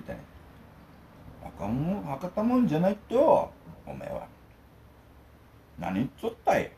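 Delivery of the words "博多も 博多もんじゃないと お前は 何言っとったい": Hakata dialect retort. Retold or imitated. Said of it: "って言いたいんですよね".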